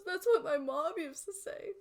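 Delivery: high, loud teary troll; troll choking up about mom. Acted. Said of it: "He starts to tear up a little bit and says".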